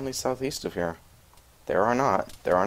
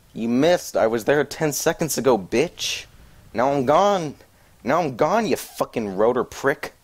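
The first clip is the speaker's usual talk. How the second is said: loud, higher mocking taunt; 5 semitones higher, 4 dB louder than usual. Real, not acted. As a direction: taunt the helicopter that missed you. Taunting helicopter after dodging.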